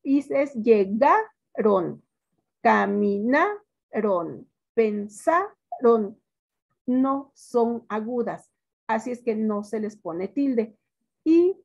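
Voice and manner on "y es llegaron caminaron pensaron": "llegaron", "caminaron", "pensaron" stretched slowly with the stress on the syllable before the last. Shown as a demonstration. Teacher to class, stretching the verbs slowly to show they are graves. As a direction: spell out each verb slowly so the stress falls on "ga", "na", "sa". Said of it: "Todos los verbos que terminan en on son graves".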